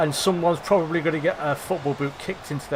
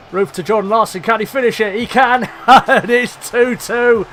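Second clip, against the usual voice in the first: rising, louder, laughing celebration; excited goal call; 4 semitones higher, 6 dB louder than usual. Real, not acted. Gamer calling his striker's equaliser, excited shout. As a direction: build up and explode with joy as he scores.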